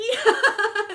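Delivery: high, loud real laugh; a quick laugh after a wry remark; short laugh. Real, not acted. Laughing at her own point.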